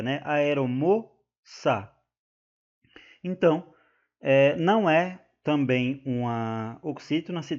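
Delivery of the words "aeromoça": "Aeromoça" slowly, mo held, ça set apart; split into syllables. Shown as a demonstration. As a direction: pause after the stressed mo in "Aeromoça". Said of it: "é a sílaba tônica".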